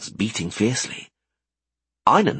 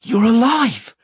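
relieved shout; 14 semitones higher, 6 dB louder, slower than usual. Acted. Relieved greeting.

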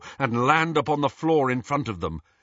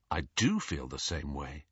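low, soft, inward; quiet; 7 semitones lower, 9 dB quieter than usual. A narrator's reading. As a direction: say it softly to yourself. Harry quietly thinks of Ginny.

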